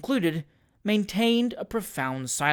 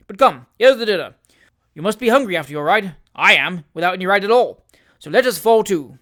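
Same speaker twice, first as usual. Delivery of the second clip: put-on bluff, louder old squire, clapping a friend on the back; hearty and consoling, a bit loud; hearty old gentleman; 8 dB louder than usual. A narrator's reading. Wardle calling to dinner.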